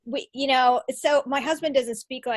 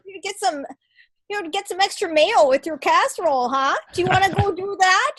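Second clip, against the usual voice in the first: mock Minnesotan accent, pitched up; hammed-up Minnesota folksy sing-song; 5 semitones higher than usual. Wife mocking her home state's speech, Minnesotan accent. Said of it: "Minnesotan accent".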